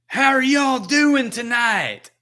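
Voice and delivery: loud, high, drawn-out Southern stage shout; heavy Southern accent. Retold or imitated. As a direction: holler to the crowd in a thick Southern twang. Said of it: "the most heavy Southern accent".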